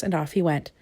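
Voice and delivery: ordinary delivery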